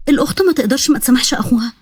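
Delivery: ordinary delivery, nothing marked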